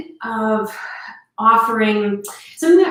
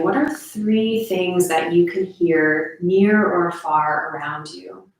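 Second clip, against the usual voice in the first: guided meditation; 2 semitones lower than usual. Real, not acted. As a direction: gently guide listeners through their senses.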